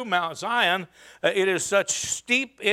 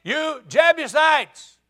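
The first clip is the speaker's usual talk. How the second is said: slow, very loud, high call; a boy shouting a challenge at the fortress; hollering up the hill; 11 semitones higher, 11 dB louder, slower than usual. Retold or imitated. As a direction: bellow up at the wall. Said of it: "he hollered and said".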